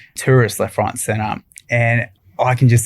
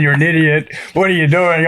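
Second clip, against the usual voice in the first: higher, mocking friend's scolding; 5 semitones higher than usual. Quoting his mate's scolding text, mock scolding. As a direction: poke fun at the harsh message.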